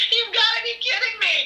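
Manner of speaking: high, stunned disbelief; as if the rug was pulled out; mock shocked outburst. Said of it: "I was like".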